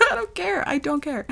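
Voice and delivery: high, small sing-out of indifference; giddy dismissal. Real, not acted. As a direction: toss it off in a high voice. Giddy outburst, then walks it back.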